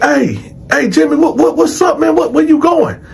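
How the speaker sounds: high, stammering questions; urgent calling out. Retold or imitated. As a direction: holler after a man who is leaving. Teller calling to Jimmy from the bars.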